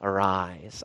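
soft, low and gentle; tender. Acted. Jesus waking the dead girl, soft and tender. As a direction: wake her as gently as a father would. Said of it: "it's so tender".

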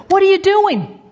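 high-pitched challenge; mock in-your-face questioning; confrontational. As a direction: demand it right in someone's face. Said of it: "gets right in my face".

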